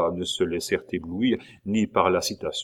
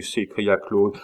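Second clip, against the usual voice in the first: ordinary delivery, nothing marked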